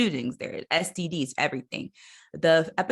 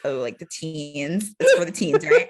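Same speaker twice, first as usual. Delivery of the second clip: hammed-up eager kid discovering the teen channel; mock teen excitement; 5 dB louder than usual. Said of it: "I was like, we're all like".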